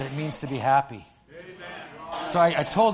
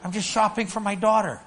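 a hint of startled protest, pitched up; surprised denial; 5 semitones higher than usual. Pastor telling cops he is just shopping, mildly startled. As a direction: protest innocence with a jolt. Answering cops accused of drinking.

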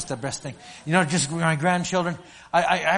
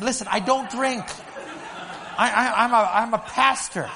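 pitched up, laughing through the denial; laughing protest; 7 semitones higher than usual. Retold or imitated. Pastor laughing as he tells the cop he is a pastor. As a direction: insist you don't drink while laughing.

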